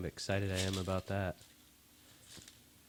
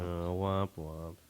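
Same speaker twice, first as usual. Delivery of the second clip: mock sad trombone; much slower than usual. Mocking a letdown.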